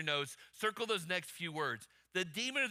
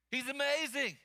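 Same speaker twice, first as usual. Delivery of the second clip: raised, higher proclamation; emphatic exclamation; 6 semitones higher than usual. Real, not acted. Preacher telling congregation about Jesus, exclaimed emphatically. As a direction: lift it up and proclaim it.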